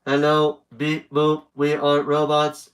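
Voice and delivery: loud, even robot monotone; mock robot greeting. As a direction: talk like a robot, syllable by syllable.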